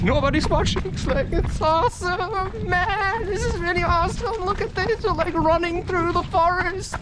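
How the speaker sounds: put-on high, childlike thrill in the voice; mock giddy wonder; giddy excitement. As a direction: gush like a kid loose in the woods. Giddy forest runner acted out.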